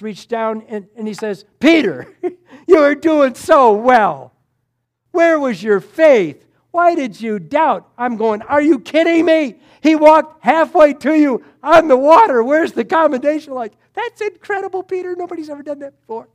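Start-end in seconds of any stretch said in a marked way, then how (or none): 1.6-7.9 loud, stretched-out words; raised, higher voice of a master reproving; acted reproach. Jesus reaching to sinking Peter. 8.4-13.5 loud, high-pitched disbelief; acted indignant outburst; incredulous protest. Preacher's acted disbelief at rebuke. 13.9-16.3 very high, gushing praise; exaggerated high-voiced admiration; mock amazed praise. Imagined praise he expected for Peter.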